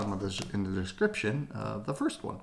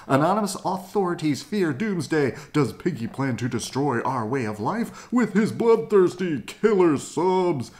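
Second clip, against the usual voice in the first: sensational news read; 5 semitones higher, 5 dB louder than usual. Acted.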